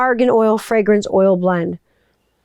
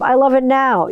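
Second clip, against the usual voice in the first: ordinary talk, nothing marked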